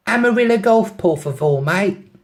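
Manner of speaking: put-on high, loud London accent on Spanish; London-accented Spanglish. London girl telling the driver where to go, London accent. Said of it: "in the best Spanglish".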